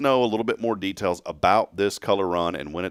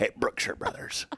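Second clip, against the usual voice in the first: softer hammed-up country twang; country accent; 6 dB quieter than usual. Host ends the shopping joke, softer country accent. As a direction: tail off in a put-on country accent. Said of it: "That sounds real country".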